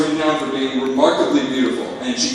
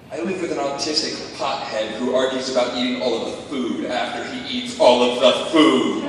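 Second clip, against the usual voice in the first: ordinary talk, nothing marked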